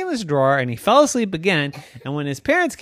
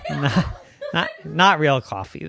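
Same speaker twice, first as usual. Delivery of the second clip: plain reading voice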